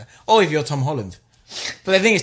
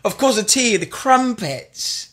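mock-posh British, drawn out and higher; hammed-up British accent; 4 semitones higher, 4 dB louder, slower than usual. Brit charming an American date, hammed-up British accent. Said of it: "the English accent".

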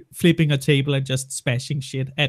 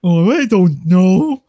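slow, louder put-on voice mocking staff; sarcastic impression of unhelpful staff; 2 semitones higher, 7 dB louder, slower than usual. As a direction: drawl it out like a clueless clerk. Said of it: "they say".

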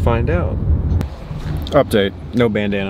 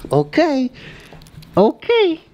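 high squeaky okay, climbing higher; playful dog talk; slower than usual. Real, not acted. Owner asking dog for last words, playful dog talk. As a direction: humour the dog in pet talk.

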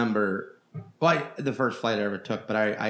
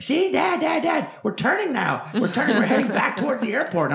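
put-on much higher, louder little-kid voice; excited kid; 12 semitones higher, 5 dB louder than usual. Kid on a plane tells his dad about the turn, excited and very high. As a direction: squeal it like a thrilled child. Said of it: "He's like".

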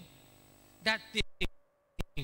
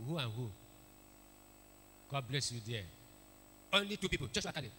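ordinary talk

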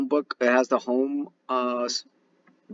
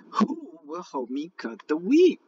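higher, slow hen voice; hen asking; 7 semitones higher, slower than usual. Acted. Little red hen asking for help, higher slow voice. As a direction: ask slowly as the little hen. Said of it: "asked the little red hen".

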